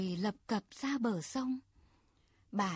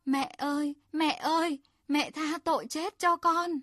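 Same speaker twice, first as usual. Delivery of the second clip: desperate cry for mercy, higher and louder; wailing plea; 6 semitones higher, 4 dB louder than usual. Acted. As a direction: beg the mother in a rising pitiful wail. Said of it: "Hắn gào lên thảm thiết".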